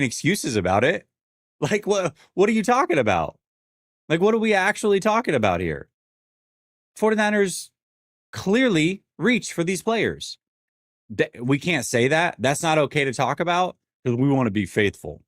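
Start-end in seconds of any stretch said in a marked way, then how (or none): 1.6-5.9 high, fast disbelief, what are you talking about; incredulous. Real, not acted. Ranting at fans making excuses, high incredulous disbelief. 7.0-15.2 mock outrage. Mocking fans who deny reaches.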